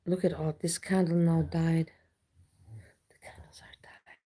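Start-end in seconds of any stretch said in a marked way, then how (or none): 3.1-4.2 breathy spooky whisper; hushed eerie whisper. Pretend. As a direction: whisper it like a ghost story. Spooky candle talk to chat.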